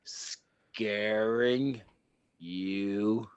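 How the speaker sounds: robot voice. Acted. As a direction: grind it out like a cyborg demanding answers. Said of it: "I had the robot voice".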